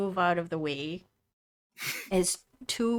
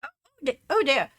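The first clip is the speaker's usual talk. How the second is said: surprised; 7 semitones higher, 5 dB louder, faster than usual. Acted. GM voices surprised dwarf woman.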